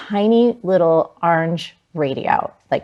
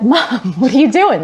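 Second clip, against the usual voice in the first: high, loud, incredulous question; astonished kid, half laughing; retold startled child; 7 semitones higher, 5 dB louder than usual. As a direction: exclaim in mock alarm at your mother. Child finding mom wrecking bathroom.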